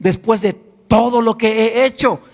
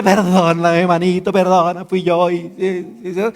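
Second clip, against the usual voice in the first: mock tearful plea; 4 semitones lower, slower than usual. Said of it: "llora y te dice".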